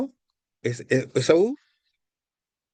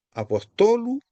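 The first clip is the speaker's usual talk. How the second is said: "apostolu" stressed on TO, high pitch; teacher's model "apostolu", accent shifted to third syllable; stress on penult; 8 semitones higher than usual. Shown as a demonstration. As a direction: jump up on TO in "apostolu". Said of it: "se cambia siempre en la penúltima sílaba".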